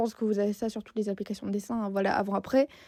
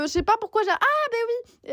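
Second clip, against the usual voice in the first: sudden realisation; 11 semitones higher, 3 dB louder than usual. Real, not acted. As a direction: realise it all at once. Sudden realisation mid-sentence.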